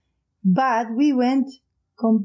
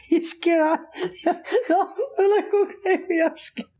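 laughing; 9 semitones higher than usual. Real, not acted. Laughing retelling childhood fright.

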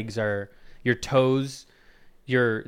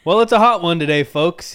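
louder, higher and quicker, like a weather announcer; mock radio host; 5 semitones higher, 10 dB louder than usual. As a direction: sell the weather to the folks.

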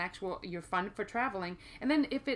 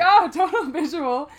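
laughing; 7 semitones higher, 15 dB louder, slower than usual. Retold or imitated. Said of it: "And I was like".

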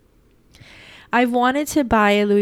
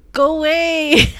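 higher, louder, drawn-out plea; exasperated outburst; 6 semitones higher, 5 dB louder, slower than usual. Acted. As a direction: wail it slowly, fed up. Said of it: "That's how I feel right now".

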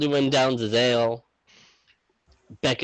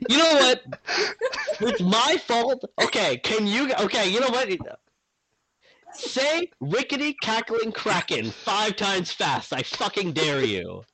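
high-pitched, laughing indignation; mock indignation; 9 semitones higher than usual. Real, not acted. Game master challenging teasing players, mock-indignant and high. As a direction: dare them in a high, exasperated voice.

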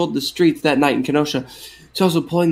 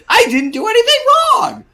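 Seinfeld voice. Acted. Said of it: "That was like Seinfeld there".

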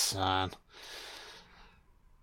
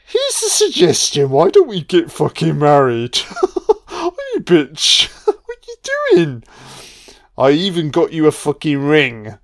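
much higher, hammed-up lovesick killer with laughs; mock proposal voice; 10 semitones higher, 3 dB louder than usual. The killer proposing marriage to Laurie, high and hammed-up.

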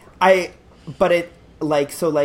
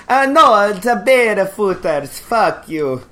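bad Russian accent; 3 semitones higher, 5 dB louder than usual. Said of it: "That was also bad".

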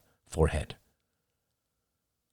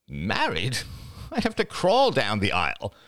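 laughing it off. Acted. Laughing off the question.

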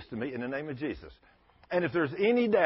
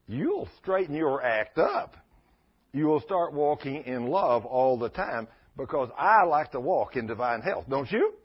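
normal talking voice

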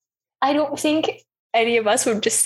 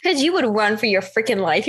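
normal talking voice

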